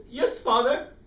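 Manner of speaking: put-on timid boy. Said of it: "And I said".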